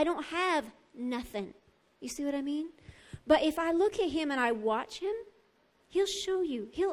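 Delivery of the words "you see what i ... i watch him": normal talking voice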